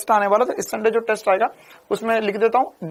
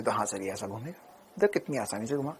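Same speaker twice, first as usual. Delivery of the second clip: a lightly voiced student, much softer and lower; student's reply; 12 semitones lower, 14 dB quieter than usual. Teacher voices a student correcting him, softly. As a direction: answer the teacher meekly as a pupil.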